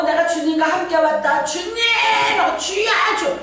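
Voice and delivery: high, stretched call to the Lord; proclaimed prayer cry. Retold or imitated. Preacher shows members what to proclaim, high crying call. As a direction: imitate a hilltop prayer shout. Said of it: "저 주여를 선포하고".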